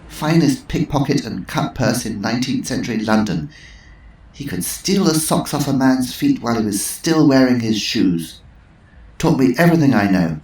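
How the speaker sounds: Missus Twistleton. Acted. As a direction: savour the name. Twistleton voiced.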